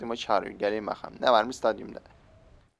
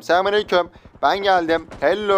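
mock greeting; 5 semitones higher, 6 dB louder than usual. Real, not acted. Taunting greeting as he arrives.

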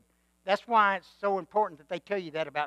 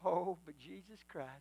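tender hush; 3 semitones lower, 10 dB quieter than usual. Acted. Tender turn after climax.

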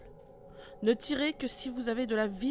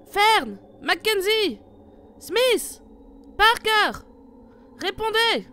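loud, high, slow calls into the fog; calling out names; 7 semitones higher, 8 dB louder, slower than usual. Acted. Leader calling the other teams, loud slow shouts. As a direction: call each name, hoping for an answer.

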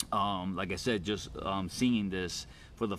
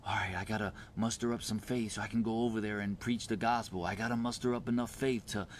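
fast, softer imitated inner monologue; hurried self-talk; 5 dB quieter, faster than usual. Believer talking oneself into stepping out, hurried soft self-talk. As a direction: rush through it under the voice, piling up obligations. Voices anxious old way of thinking.

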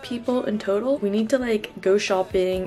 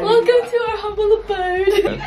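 high-pitched, laughing mock welcome; hammed-up grand welcome in a high voice; gushing hostess welcome; 10 semitones higher, 3 dB louder than usual. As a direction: play the over-the-top hostess. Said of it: "opening the door and be like".

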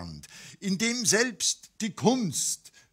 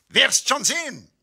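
high, loud bragging little egg; boasting egg; 10 semitones higher, 7 dB louder than usual. A narrator's reading. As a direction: brag like a puffed-up egg. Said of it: "prahlte das Ei".

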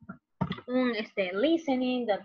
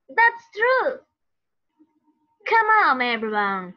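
acted rallying call; 3 semitones higher, 6 dB louder than usual. Reading teacher's lines in drama script.

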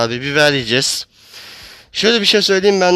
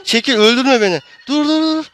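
panicked; 8 semitones higher than usual. Real, not acted. Panicked pleading while being killed.

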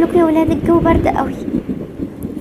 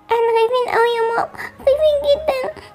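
put-on high little-child voice, frightened; scared child; 7 semitones higher than usual. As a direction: play a frightened kid pleading to mama. Child figures, scared at night. (صوت طفل خايف)

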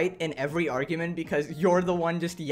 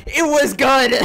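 shrieked answer, much louder and higher; hammed-up imitation of her yelling; mock scream; 6 semitones higher, 12 dB louder than usual. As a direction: scream a cheerful reply. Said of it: "I can't even emulate the screaming".

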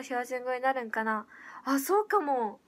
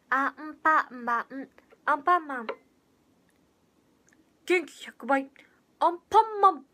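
a light Anpanman imitation, louder; Anpanman impression; 2 semitones higher, 6 dB louder than usual. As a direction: chirp it like a cheery cartoon hero. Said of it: "似とるかも".

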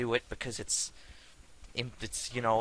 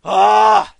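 loud high scream of rage; frustrated scream; 10 semitones higher, 21 dB louder than usual. Real, not acted. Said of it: "that was quite the reaction".

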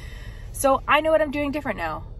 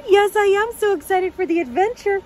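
high, loud gleeful gushing; excited puppy talk; 6 semitones higher, 7 dB louder than usual. Pretend. Answering for the puppy about the adventure, high excited gushing. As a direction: bubble over with excitement for the puppy.

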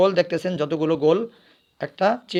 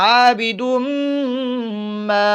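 a slow, melodic recitation of the word; recitation demo; 6 semitones higher, much slower than usual. Shown as a demonstration. Teacher showing pupils how to recite, melodic. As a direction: chant it the way the pupils should read it. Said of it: "কিভাবে?".

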